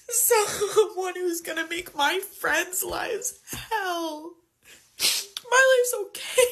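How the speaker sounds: slow, tear-choked, rising high; laugh-cry through the words. Real, not acted. Young woman crying over her friends' future. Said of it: "Forgive me for being a little emotional here".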